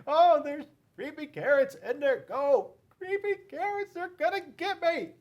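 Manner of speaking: put-on high, loud frightened boy; boy pleading with mom about carrots; scared kid. A narrator's reading. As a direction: beg her to look, scared stiff. Jasper panics about the carrots.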